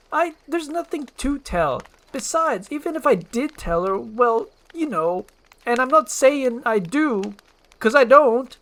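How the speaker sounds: high, loud, flustered protesting; defensive denial. Acted. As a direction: deny it too hard. Shrek denies his feelings.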